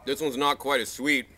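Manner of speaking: talking around food; chewing while talking. Real, not acted. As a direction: mouth partly full. Seen 1: Bites fruit before; lips pursed, jaw chewing during early words.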